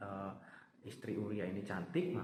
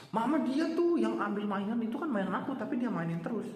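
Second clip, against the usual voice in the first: put-on high, louder protesting child; defiant child; 9 semitones higher, 5 dB louder than usual. A child talking back to mama, high and protesting. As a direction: argue back to mom like a kid.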